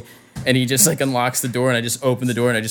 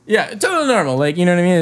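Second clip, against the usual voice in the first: higher, louder mock-casual sarcasm; sarcastic; 6 semitones higher, 5 dB louder, faster than usual. Real, not acted. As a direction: say it like it is anything but normal. Sarcastic about trash bags.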